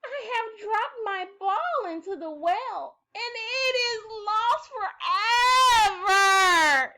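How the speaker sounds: high, slow, crying wail, loudest on "forever"; wailing princess. Acted. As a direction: sob it out as if the ball is gone forever. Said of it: "she wailed".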